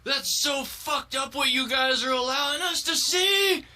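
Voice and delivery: high outraged shout rising to a shriek. Acted. Boy hauled out of class in a tantrum, shouting. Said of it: "while he's shouting".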